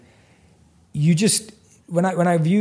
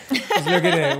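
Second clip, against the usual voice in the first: laughing. Real, not acted. Excited about guest's mind.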